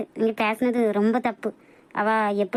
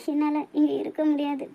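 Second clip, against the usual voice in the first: ordinary delivery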